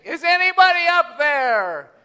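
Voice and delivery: high, loud cry for help from below a cliff; hanging off a ledge, shouting up for rescue; calling for help. Acted. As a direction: yell up desperately to anyone above. Man hanging from cliff calls.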